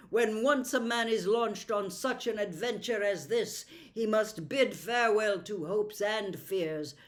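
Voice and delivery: loud noble call; rally them with authority; commanding prince. Acted. Prince rousing the party, loud commanding voice.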